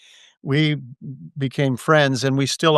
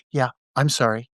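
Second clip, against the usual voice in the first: light airy voice; 3 semitones lower than usual. Retold or imitated. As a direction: say it lightly, almost on a breath. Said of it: "with that little light, airy voice".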